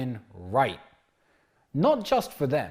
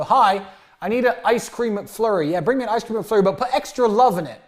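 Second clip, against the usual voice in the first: cheeky order; 7 semitones higher, 5 dB louder than usual. Retold or imitated. Ordering at drive-through window.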